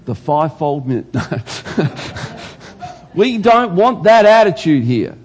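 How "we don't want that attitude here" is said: louder, firm and emphatic; firm rebuke. Real, not acted. Preacher banning judgmental attitude, loud and firm. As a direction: put your foot down. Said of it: "preacher rebuking the congregation".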